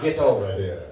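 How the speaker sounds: low Mortal Kombat growl of a command; low, mock-menacing call; video game fighter. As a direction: order them over like a game character. Mimics Mortal Kombat character.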